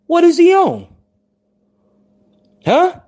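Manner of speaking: what is he on, pushed high and loud. Real, not acted. Streamer demanding an answer from his viewers, loud and high. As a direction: fire the question at the audience. Heated rant about JT.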